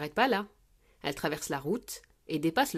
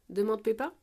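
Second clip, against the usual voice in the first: ordinary delivery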